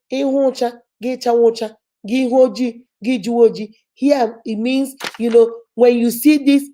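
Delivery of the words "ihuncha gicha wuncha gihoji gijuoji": "Ihuncha, gicha wuncha, gihoji, gijuoji" said in Igbo with its tones; Igbo proverb. Shown as a demonstration. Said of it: "in Igbo language, we say".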